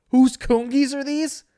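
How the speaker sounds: high, loud, drawn-out mimicry; mocking quote. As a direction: throw his fake amnesia line back at him. Mocking friend's amnesia line.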